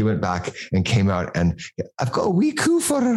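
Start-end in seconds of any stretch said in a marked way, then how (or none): 2.0-3.2 high, badly faked Scottish old lady; a wobbly mock Scottish shop lady; terrible Scottish accent. Shop lady fawning over the baby, in a hammed-up Scottish accent. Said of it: "channeling of Missus Doubtfire".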